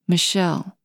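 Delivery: ordinary delivery, nothing marked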